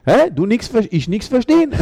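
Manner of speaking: mock pidgin German. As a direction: throw his broken German right back at him. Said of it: "hab ich gesagt".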